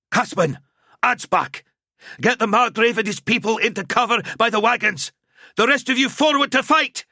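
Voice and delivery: high, loud commands over the chaos; shouted orders. A narrator's reading. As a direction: bark orders across the camp.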